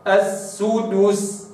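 السدس slow, full damma on dal; correct recitation. Shown as a demonstration. Teacher models the right السدس. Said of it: "هكذا".

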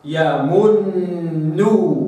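slow يمنوا with the noon clearly held; audible noon. Shown as a demonstration. Teacher showing an audible doubled noon. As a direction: let the noon in يمنوا be heard. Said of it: "هكذا".

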